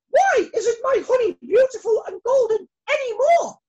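very high, loud angry demand; demanding queen. Acted. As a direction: insist on an answer, shouting. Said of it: "she demanded to know".

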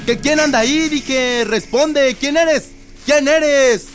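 high, loud, panicked demands; frightened shouting. Acted. Rubén yelling at an unseen presence, panicked. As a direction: shout into the dark, scared and furious.

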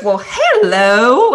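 big, drawn-out Southern greeting, high and loud; attempted Southern accent. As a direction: greet them in a slow, sing-out Southern drawl. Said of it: "can't even do her Southern accent".

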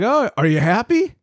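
high, sing-song mock of the boss. Retelling the boss asking if he's happy, high mock voice. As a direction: mimic his chirpy question. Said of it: "He's like".

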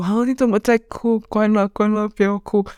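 high-pitched imitation of startled, fussing girls; 模仿惊慌安慰. 妹妹和表妹跑来安慰. (学妹妹们高声惊讶地哄人)

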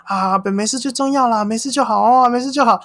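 high, eager reassuring repeats; imitating the peacemaker. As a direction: keep soothing like a nosy bystander.

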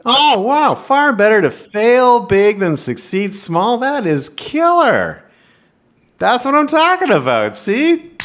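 host loving the Russian quote, excited and slow; thrilled. Real, not acted. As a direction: savour every word, wildly impressed.

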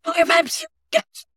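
very high squeaky cheerleader voice; imitating Becky. Imitating Becky cheering her on, very high squeaky voice. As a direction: cheer like a tiny fan in the stands. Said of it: "Becky's like".

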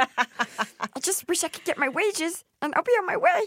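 high strangled put-on voice; squeezed throat. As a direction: squeak it out as if choked. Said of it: "like I'm squeezing your throat".

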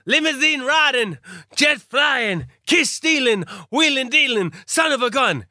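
high, punchy wrestling-promo voice; Dusty Rhodes. Acted. Mimicking a wrestler's boast to a co-host, promo voice. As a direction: chant each boast as a wrestler would. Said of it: "why are you doing a Dusty Rhodes accent then".

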